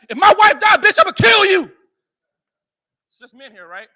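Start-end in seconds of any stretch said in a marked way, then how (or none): 0.1-1.7 loud, higher furious shout; furious threat. Retold or imitated. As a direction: scream the threat at the nurse. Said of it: "I had to threaten the nurse".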